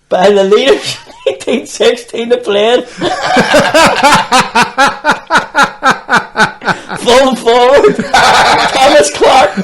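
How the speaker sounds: high, loud words broken by laughter; can barely get it out for laughing; laughing through it. Real, not acted. Retelling raffle, laughing through it.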